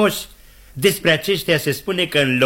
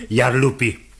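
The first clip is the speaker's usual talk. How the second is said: ordinary delivery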